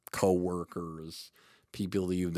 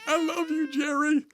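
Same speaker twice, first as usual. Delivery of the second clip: high, loud, hammed-up crying voice; mock movie sobbing; 8 dB louder than usual. Guest parodies the Jerry Maguire ending, mock sobbing. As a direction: ham up a tearful film farewell. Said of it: "this is the end of Jerry Maguire".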